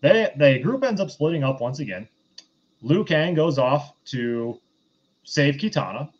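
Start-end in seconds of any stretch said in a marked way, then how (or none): none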